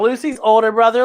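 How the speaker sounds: ordinary delivery, nothing marked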